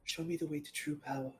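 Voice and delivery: very soft, quick, low murmur; hushed request. Acted. Swayed enemy speaks to his new ally, hushed and low. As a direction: drop right down, almost under the breath.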